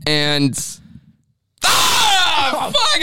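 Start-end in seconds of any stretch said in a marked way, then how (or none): 1.6-3.0 real outburst. Real, not acted. Losing it on the five second clock, shouting. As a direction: yell it out as the clock runs. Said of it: "I lost it".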